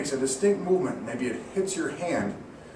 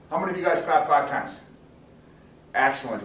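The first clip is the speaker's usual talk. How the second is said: normal talking voice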